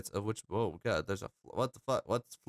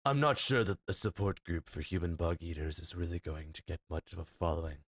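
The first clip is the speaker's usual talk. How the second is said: a lower voice for the dragon friend; lightly voiced dragon, a touch lower; 7 semitones lower, 4 dB quieter than usual. Player voicing Draggy the dragon, lightly lower. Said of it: "on a dragon voice".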